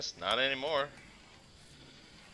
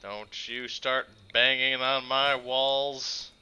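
very slow, stretched-out warning; slow menacing warning to the creature outside; slow threat; 2 semitones higher, 4 dB louder, much slower than usual. Real, not acted. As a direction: drag every word out as a threat.

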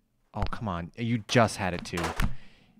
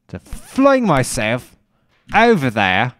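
odd put-on voice; 2 semitones higher, 6 dB louder, slower than usual. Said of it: "why am I talking like that?".

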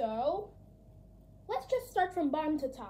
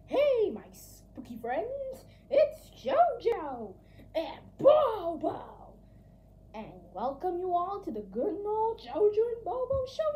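show host intro; 5 semitones higher, 5 dB louder than usual. Acted. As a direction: welcome the audience like a spooky host. Hosting spooky show intro.

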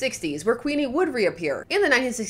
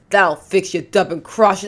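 loud snarl; 7 dB louder than usual. Acted.

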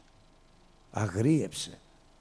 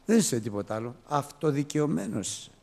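usual speaking voice

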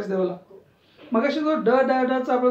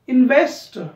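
Investor loud with VES punched; stress on VEST; 7 dB louder than usual. Shown as a demonstration. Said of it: "वेस्टला जोरात म्हटलं".

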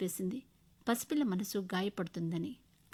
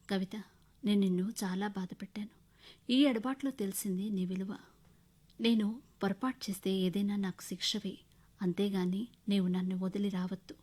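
ordinary delivery

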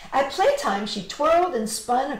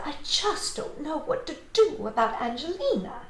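higher-pitched, lightly voiced fretting mother; worried mother; 6 semitones higher than usual. A narrator's reading. Mother confiding in father about Angelina, mildly worried. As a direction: fret mildly, at a loss.

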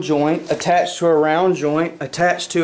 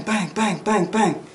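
quick even string of 'bang' with no gaps, higher pitched; rapid-fire bangs; 4 semitones higher than usual. Shown as a demonstration. Instructor showing students the faster shooting tempo, rapid-fire bangs. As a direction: imitate fast follow-up shots, bang after bang. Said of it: "Hear the tempo?".

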